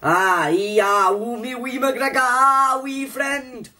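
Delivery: high, hammed-up Scottish squeal; mock Scottish accent. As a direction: send up a Scottish man in a very high voice. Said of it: "you Scottish man".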